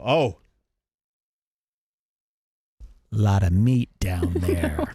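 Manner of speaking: low, slow leering remark; slow lewd low murmur; leering appraisal. Retold or imitated. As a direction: drag it out like you're sizing her up. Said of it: "And then I'll go".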